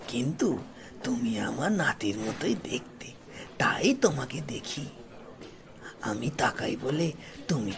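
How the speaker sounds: put-on low, soft, half-whispered old man; an old grandfather speaking tenderly under his breath; gentle old man. A narrator's reading. Old man answers the boy at the gate, low and soft. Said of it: "প্রত্যুত্তরে বৃদ্ধ বলেন".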